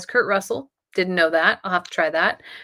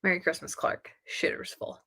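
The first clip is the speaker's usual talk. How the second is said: quoting the movie; 2 semitones lower, 9 dB quieter than usual. Retold or imitated. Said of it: "nothing better than Christmas Vacation".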